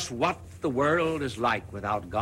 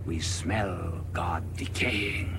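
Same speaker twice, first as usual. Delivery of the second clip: grim whisper; 5 semitones lower, 3 dB quieter than usual. Acted. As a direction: whisper like hearing graves dug. Madman's grim vision whispered.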